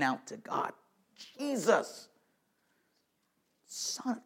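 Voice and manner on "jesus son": a soft, breaking cry of Jesus' name; lightly voiced desperate plea; hushed crying out. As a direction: cry to God under the breath. Voicing the beggar's cry.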